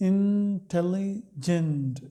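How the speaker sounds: Intelligent drawn out slowly, syllable by syllable; slow teacher's demo of intelligent. Shown as a demonstration. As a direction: model intelligent slowly for students to count syllables. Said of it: "polysyllabic हम कहेंगे intelligent में".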